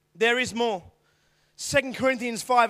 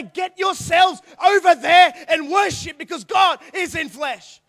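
commanding shout; 4 semitones higher, 6 dB louder than usual. Retold or imitated. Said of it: "and say".

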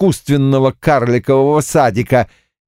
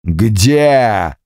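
demon bull voice; 3 semitones higher, much slower than usual. Acted.

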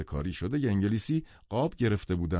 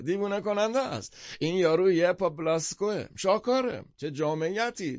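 triumphant cry; 8 semitones higher than usual. A narrator's reading. Half-drunk man shows visitors his proof, triumphant. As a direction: crow over your proof like a tipsy enthusiast. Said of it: "پیروزمندانه فریاد کشید".

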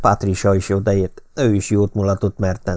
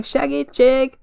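desperate cry for help; slower than usual. A narrator's reading. Someone in the trees crying out again desperately. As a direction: scream it again, more frantic. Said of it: "kiabált valaki kétségbe esetten".

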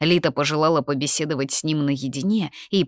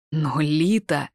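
mildly taken-aback protest; disconcerted; slower than usual. A narrator's reading. Corvin hears of the delay and protests, disconcerted. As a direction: object as if thrown by the news. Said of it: "казался обескураженным".

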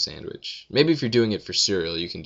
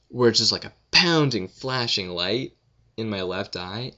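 ordinary delivery

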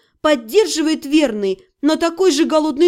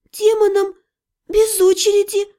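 high, strained gasp of words; choked croak; 6 semitones higher than usual. A narrator's reading. Mage choking on demon's fumes, strained croak. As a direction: squeeze it out, short of breath. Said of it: "прохрипела я".

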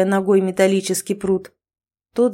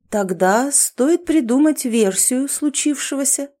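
higher, silky and ingratiating; insinuating voice; 6 semitones higher than usual. Acted. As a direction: coax him smoothly. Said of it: "вкрадчивым голосом предложил исполнитель".

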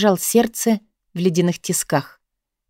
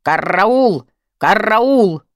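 squawking bird with long rolled r; parrot voice; much slower than usual. A narrator's reading. Parrot gets active on seeing its keeper, squawking. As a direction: screech it like a talking parrot, trilling the r. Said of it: "Попугай при виде Оли задвигался".

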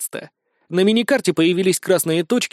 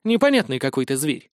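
plain reading voice

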